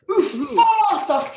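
uafásach stretched out very slowly and high; mock-dramatic uafásach; drawn-out uafásach. Shown as a demonstration. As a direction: linger on each syllable of uafásach. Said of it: "Uafásach is awful".

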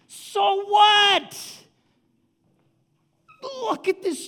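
loud, high preacher's exclamation; full-force preaching. Real, not acted. Preacher exclaiming how big God is, impassioned. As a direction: belt out the wonder to a crowd.

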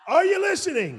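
high, loud call to the crowd; rousing call-out. Real, not acted. Preacher calling out to his congregation, high and loud. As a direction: shout the question up to the room.

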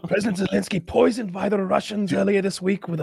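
high mock newscast; news reporter. Media reporting a fake poisoning, high news voice. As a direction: read it out as a breaking story.

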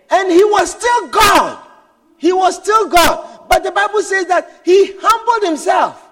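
loud, high, shouted preaching; full-force preaching. Acted. Preacher shouting to the congregation about Jesus. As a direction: proclaim it to the whole church.

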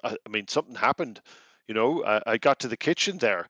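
lightly voiced Irish captive, rambling; Irish traveller voice. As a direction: tell it like a shaken Irish wanderer. GM plays Ned the captive.

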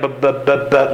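imitated stutter on "b"; stuttering babble. Shown as a demonstration. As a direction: stammer out repeated "buh" sounds like babble. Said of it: "like somebody stuttering".

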